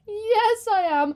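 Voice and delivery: high, loud hyped-up affirmation; mock excitement. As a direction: repeat it with playful, over-the-top glee. Hyped repeat answering own question.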